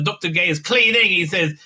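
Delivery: ordinary delivery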